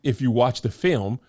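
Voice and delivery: a touch of exasperated rebuttal; retorting. Retold or imitated. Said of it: "And I'm like".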